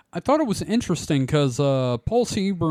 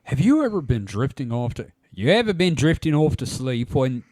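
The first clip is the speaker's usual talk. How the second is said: dramatic trailer voice. Shown as a demonstration. Host starts the article in a mock dramatic trailer voice. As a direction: start it like an ominous trailer, then drop it. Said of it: "no, I'm not doing it".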